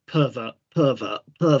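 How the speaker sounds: muttered pervert. Retold or imitated. Heckling Geordie on screen with a low muttered pervert. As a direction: grumble pervert under your breath. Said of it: "I'm like".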